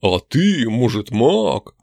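slow, drawn-out questioning elf; sceptical elf. Acted. Elf sizing up a strange visitor, slow and questioning. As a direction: draw the question out slowly.

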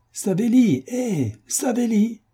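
high ringing call across the square; clear call. A narrator's reading. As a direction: summon the peasant, slow and high. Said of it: "cria Bagrianov de sa voix la plus nette".

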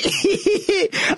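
high witch's cackle; evil cackle. A narrator's reading. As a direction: cackle with wicked glee. Witch laughs at threat.

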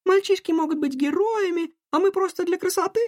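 put-on high girl voice, indignant; young girl voice. A narrator's reading. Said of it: "поддержала её Салли".

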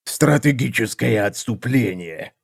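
dry, unraised echo. A narrator's reading. Seer repeats assassin's excuse, dry. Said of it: "сухо повторил Танкуоль".

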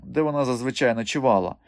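ordinary delivery, nothing marked

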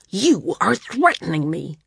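understated flare of fury; sudden fury. A narrator's reading. Landsman rounds on Maris for threatening him, sudden fury. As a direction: voice jumps in indignant anger. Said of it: "said in sudden fury".

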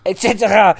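loud, high French et cetera; mock French accent. Mocking co-host's French et cetera, French accent. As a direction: throw it back in exaggerated French.

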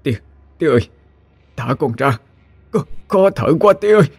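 high, strained gasping plea to father; choked, pleading young man; choking plea. Acted. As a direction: beg while being strangled. Son choking under father's grip.

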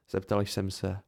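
ordinary delivery

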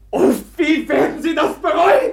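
anguished cry. Acted. After the slap the outraged woman cries out, a loud anguished cry. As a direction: cry it out across the room.

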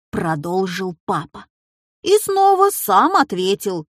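usual speaking voice

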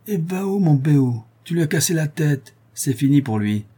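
keen, teasing delight; excited teasing. Acted. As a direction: rib your friend with a laugh in your voice. Anicet teasing Corentin.

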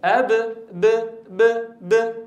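short light ب bursts; baa demo. Shown as a demonstration. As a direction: say أب and ب lightly and flat. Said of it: "أم".

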